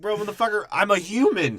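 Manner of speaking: whiny half-yell at the robot; mock panicked human. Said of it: "He's like".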